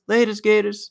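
"Laters, Gators" in a high, mock British accent; imitating British accent. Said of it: "In, in his accent".

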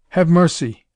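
loud, desperate pleading cry; hoarse plea. A narrator's reading. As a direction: beg for your life, hoarsely. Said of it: "hoarsely pleaded Hero John".

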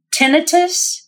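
tinnitus as TIN-ih-tus, rising in question; first-syllable stress. Shown as a demonstration. Host checking the pronunciation with her guest, tinnitus stressed on first syllable. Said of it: "The emphasis is on the first syllable".

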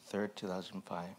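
ordinary delivery

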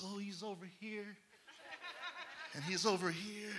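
very soft, hushed mimicry; tiny hushed voice, lightly mocking; mock wonder. Mimicking people following the wind, in mock hushed wonder.

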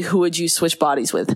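usual speaking voice

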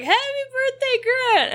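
high-pitched celebratory call, not full volume; mock shout. Telling listeners how to wish him happy birthday, high mock shout. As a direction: pretend to shout it to the sky. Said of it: "shout it to the sky".

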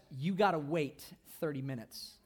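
a light hint of a nagging mother; mock scolding mom. Mom telling the kid to wait before swimming, mildly stern. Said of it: "Mom says".

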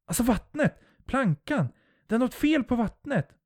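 very high, frantic exclamations; panicked insistence. A narrator's reading. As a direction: desperately try to make them understand. Panicking narrator insists on danger. (panikslaget och gällt)

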